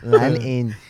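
mock Chinese-accented device prompt. Echoing the friend's speaker prompt, mock Chinese accent. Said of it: "You have a Chinese accent?".

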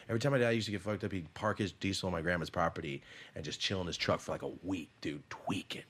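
ordinary talk

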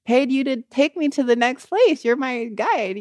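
mock the terrified wailing; retold panic. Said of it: "crying, screaming".

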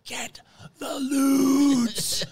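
very slow, high, crying shout; drawn-out desperate shout. Retold or imitated. Boss screaming in his face on the boat, slow crying shout. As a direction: stretch every word into a wailing scream. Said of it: "he goes up to me and goes".

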